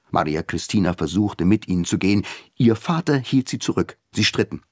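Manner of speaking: usual speaking voice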